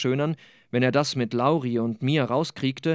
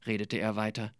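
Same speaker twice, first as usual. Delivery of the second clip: ordinary delivery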